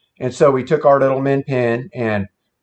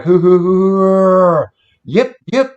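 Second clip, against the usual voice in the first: higher, louder hoots ending in sharp yips; angry owl hoots; 7 semitones higher, 5 dB louder, slower than usual. Shown as a demonstration. Showing friends the angry owl call, loud and high. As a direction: hoot like an angry owl, then yip. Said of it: "sounded like an angry owl".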